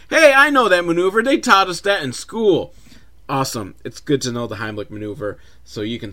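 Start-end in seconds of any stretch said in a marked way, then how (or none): 0.1-2.7 put-on high, loud eager kid voice; a kid proudly recognizing something; excited little boy. Little boy watching the story, excited and loud. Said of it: "the little boy's like".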